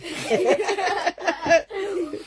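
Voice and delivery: long high laugh; real long laugh. Real, not acted. Bursts laughing.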